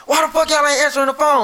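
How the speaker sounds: loud, high angry demand; imitated mad dad. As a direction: act out an irate father demanding answers. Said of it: "the reason why he was so mad".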